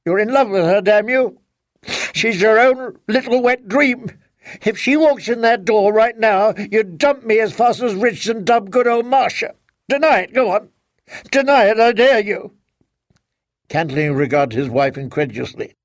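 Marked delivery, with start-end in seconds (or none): 0.0-9.5 crying anger. A narrator's reading. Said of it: "She was crying now". 9.9-10.7 high, loud daring taunt; tearful challenge. A narrator's reading. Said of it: "She was crying now". 11.3-12.5 highest, loudest challenge; tearful dare, peaking. A narrator's reading. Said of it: "She was crying now".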